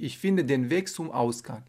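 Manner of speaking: normal talking voice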